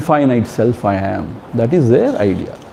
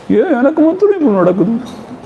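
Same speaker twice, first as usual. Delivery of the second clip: much higher, whinier mock lament; exaggerated self-pity; 9 semitones higher than usual. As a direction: push it up into an over-the-top whine. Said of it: "sympathize means".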